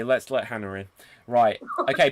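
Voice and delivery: ordinary delivery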